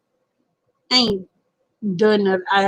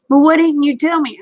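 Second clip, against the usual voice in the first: higher, slightly louder caller's complaint; mild mock protest; 5 semitones higher, 4 dB louder than usual. Caller questioning a sleepy person, mock protest. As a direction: imitate a caller's protest, pitched up.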